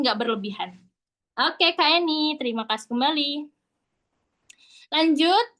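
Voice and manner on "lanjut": usual speaking voice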